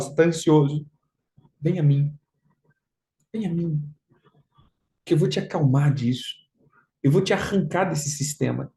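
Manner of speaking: voz mais grave, serena e pausada; Jesus acalmando. Retold or imitated. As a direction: console o ansioso com calma, frase por frase. Voz de Jesus consolando o ansioso. (convite sereno de Jesus, em tom grave)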